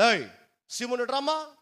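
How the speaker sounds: Jesus calling Simon to come here. Retold or imitated. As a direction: summon Simon over.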